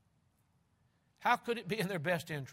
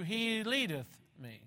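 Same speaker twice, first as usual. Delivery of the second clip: "leadeth" drawn out slowly; stress on leadeth; 5 semitones higher, slower than usual. Shown as a demonstration. Preacher dwelling on the word, stressing "leadeth". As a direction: linger on "leadeth". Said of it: "place the emphasis where God places the emphasis".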